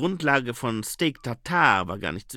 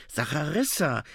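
voiced, higher call of her name; insistent call; 4 semitones higher than usual. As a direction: say her name aloud to stop her. Calls her name again, more insistently. (eindringlicher Zuruf)

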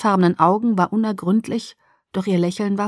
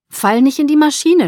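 teasing jab, higher and louder; mocking; 7 semitones higher, 6 dB louder than usual. A narrator's reading. Edward teases Bella about her laundry, mocking. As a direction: poke fun at her, pitched up. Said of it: "spottete er".

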